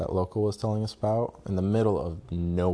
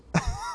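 high laugh. Real, not acted. Laughs at girlfriend's annoyance.

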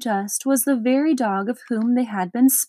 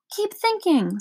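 much higher, sharp telling-off; scolding; 8 semitones higher than usual. Acted. Watchdog urges Milo not to stop, scolding. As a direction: scold him to keep going. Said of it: "scolded the watchdog".

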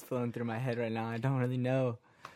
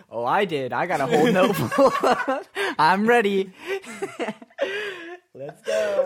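hyped; 8 semitones higher, 10 dB louder, slower than usual. Real, not acted. Hyped to rap.

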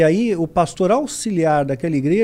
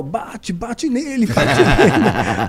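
slow, egging on with a laugh; wife egging on, laughing; mock urging wife; 4 semitones higher, 4 dB louder, much slower than usual. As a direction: urge him to hit, slowly, half laughing. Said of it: "E ela só fazendo sinal".

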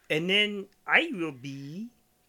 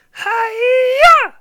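high, loud shrieking karate chop; Miss Piggy karate cry; 20 dB louder than usual. Shown as a demonstration. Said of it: "Can you do this? Go".

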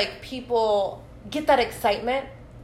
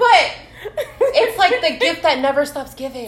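laughing; 5 semitones higher, 7 dB louder than usual. Real, not acted. Still laughing.

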